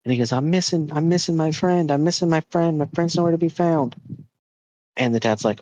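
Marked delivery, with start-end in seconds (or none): 0.3-4.0 higher-pitched imitation of a distressed child; upset little boy. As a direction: plead like a boy who lost his friend. Upset boy voiced in story.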